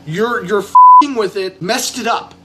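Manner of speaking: loud, angry scolding. Real, not acted. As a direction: tell them off, loud. Drunk rant at the studio.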